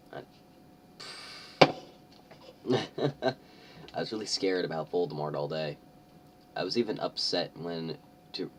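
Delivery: ordinary talk, nothing marked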